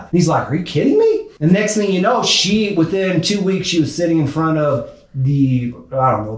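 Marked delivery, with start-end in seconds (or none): 0.5-1.2 high-pitched retold disbelief; mock exasperated astonishment; incredulous. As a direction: imitate an astounded coach, voice shooting up. Coach reacts to client's flight news.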